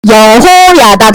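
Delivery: low, slow 여호야다; slow model for repeating. Shown as a demonstration. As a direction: draw out each syllable of 여호야다. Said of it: "다 같이 해보자".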